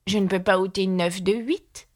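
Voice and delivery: ordinary delivery, nothing marked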